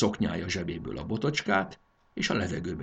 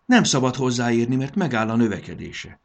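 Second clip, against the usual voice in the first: raised, insistent voice; higher, forceful caution; urgent warning; 4 semitones higher, 7 dB louder than usual. A narrator's reading. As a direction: warn loudly against touching. Urgent warning after the shout.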